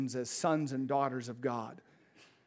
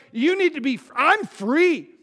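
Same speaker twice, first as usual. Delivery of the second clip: passionate testimony; 5 semitones higher, 6 dB louder than usual. Retold or imitated. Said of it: "He's like".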